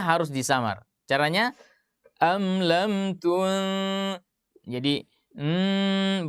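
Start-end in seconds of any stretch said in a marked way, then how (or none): 2.2-6.3 "tun-" stretched very slowly into a hummed nasal mm; nasal ikhfa demo. Shown as a demonstration. Teacher demonstrating ikhfa humming, slow and held. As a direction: hum the nun through the nose, very slow. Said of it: "bersuara di hidung".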